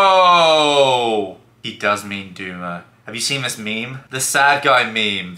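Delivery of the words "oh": long, loud "oh" of discovery; sudden realisation. Real, not acted. Boyfriend finding the word after searching it, drawn-out realisation. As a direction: draw out the penny-drop moment. Realises what Doomer means.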